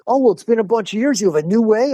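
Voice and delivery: quick and delighted, leaning in; eager curiosity. Retold or imitated. Dimmy keen to hear new way.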